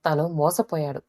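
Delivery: usual speaking voice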